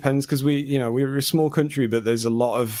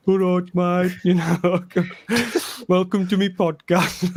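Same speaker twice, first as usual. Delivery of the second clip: laughing put-on Wolverhampton accent, pitched up; playful Wolverhampton accent; 6 semitones higher, slower than usual. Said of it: "Wolverhampton accent".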